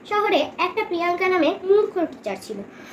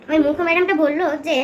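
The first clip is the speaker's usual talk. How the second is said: ordinary delivery, nothing marked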